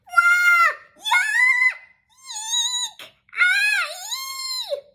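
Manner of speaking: very high, loud shrieking squeals; rising string of frantic shrieks; panicked squeals. A narrator's reading. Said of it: "Sienna squeals and squeals".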